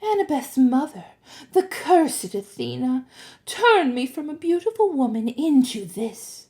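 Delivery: wronged grandmother telling her woe; poor old grandmother. A narrator's reading. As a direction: plead for sympathy in a lifted voice. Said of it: "to sympathize with a poor old grandmother".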